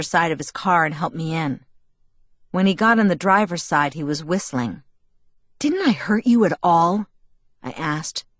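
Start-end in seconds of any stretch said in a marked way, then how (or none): 5.6-7.1 understated indignant question; furious and annoyed. A narrator's reading. Bella asking Jacob about the punch, annoyed. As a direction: ask with a touch of annoyance. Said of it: "furious and annoyed".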